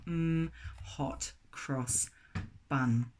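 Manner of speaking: slow, soft, dreamy mmm; savouring. Real, not acted. Vlogger dreaming of a buttered bun, slow and soft. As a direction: linger lovingly over the words.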